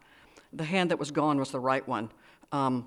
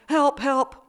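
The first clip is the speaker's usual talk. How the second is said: high, loud cry of alarm; high-pitched yell; crying out for help; 10 semitones higher, 9 dB louder than usual. A narrator's reading. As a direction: call out for help at full voice. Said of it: "she cried".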